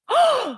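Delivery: hammed-up high gasp of the crowd; imitated audience gasp; mock gasp. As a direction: gasp as a shocked onlooker. Crowd reacting to news.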